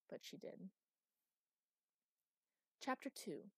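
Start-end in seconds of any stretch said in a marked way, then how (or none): none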